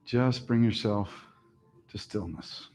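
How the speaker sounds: soft, unhurried relaxation voice; calm yoga teacher easing the class down; guided meditation. Acted. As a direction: settle them into stillness. Opens final relaxation.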